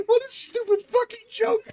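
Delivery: very high squeal through laughter; words squeezed out in a real laughing fit; helpless laughter. Real, not acted. Host cracking up at a stupid joke, helpless laughter.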